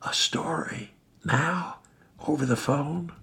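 hushed disbelief; incredulous whisper. Acted. Woken husband questioning the caller in a whisper. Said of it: "I pleaded in a whisper".

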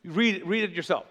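ordinary delivery

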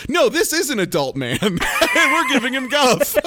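laughing through a mock line; laughing mock quote. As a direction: giggle through the quote, voice climbing. Mocking the show's logic, laughing.

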